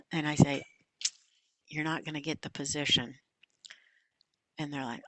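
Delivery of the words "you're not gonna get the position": soft, low delivery of bad news; lowered, careful voice; gently breaking bad news. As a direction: let them down quietly. Voicing bad news by phone.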